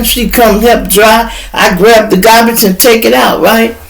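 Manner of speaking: usual speaking voice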